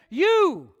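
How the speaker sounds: "You" high and loud, drawn out, falling; emphatic You. Retold or imitated. Preacher quotes the emphatic Greek word to congregation, "You" stressed. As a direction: swell on a single emphatic "You". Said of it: "You is emphatic in the Greek".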